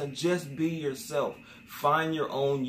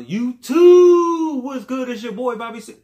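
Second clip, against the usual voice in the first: Damn D intro; 11 semitones higher, 14 dB louder than usual. Retold or imitated. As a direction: belt out a high, hyped channel intro. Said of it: "I started emulating his intro".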